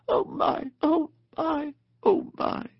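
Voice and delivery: high, breathless gasps of wonder; awed gasping. A narrator's reading. Overwhelmed mole marvelling at the river, gasping. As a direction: gasp it out in a tiny, high whimper. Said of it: "and gasp".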